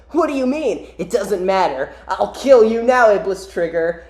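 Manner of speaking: a touch of hammy threat; vengeful rival. Acted. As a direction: brush off the taunt with mock resolve. Acted reply of attacking rival.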